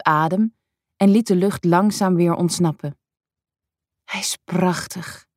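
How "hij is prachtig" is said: softer, slow breath of awe; hushed and slow, lost in wonder; awed whisper. A narrator's reading. Woman seeing the masterpiece in the light, softly and slowly awed. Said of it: "fluisterde ze".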